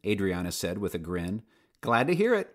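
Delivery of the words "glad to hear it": much higher, cheerful chirp; volunteer cheerfully answering the alpaca. Acted. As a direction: bounce the words up brightly. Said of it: "Rebecca chirped".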